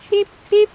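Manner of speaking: high, loud piping peep like a tiny frog; frog peeping call. Retold or imitated. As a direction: chirp it like a spring peeper. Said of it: "This frog makes a peeping call".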